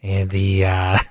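low, deep newsman voice; Jeff Wagner impression. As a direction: sink the voice deep for the news. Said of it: "I can do Jeff Wagner".

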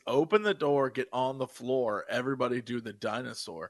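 low, soft deadpan recitation; solemn lyric recital. Acted. Explaining a silly song lyric to co-hosts, deadpan and low. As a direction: recite it gravely, like profound poetry.

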